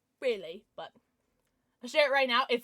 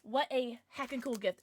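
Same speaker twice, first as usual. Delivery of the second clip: ordinary talk, nothing marked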